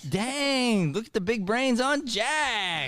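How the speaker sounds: slow, high, loud hammed-up praise; excited praise. Teacher mock-amazed at Jack's answer, hammed-up praise. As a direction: stretch it out like a mock-amazed fan.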